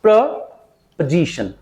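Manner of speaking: "प्रपोजिशन" slowly as "preh... puh-ZI-shun"; slow, broken model of "प्रपोजिशन" with reduced middle vowel; correct pronunciation. Shown as a demonstration. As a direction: pause after "preh" in "प्रपोजिशन", then weak "puh" and stressed "ZI". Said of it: "correct pronunciation क्या होगा".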